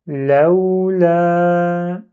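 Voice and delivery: chanted, stretched word; recitation demo. Shown as a demonstration. Teacher recites exercise six, chanted. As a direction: recite it slowly for the class.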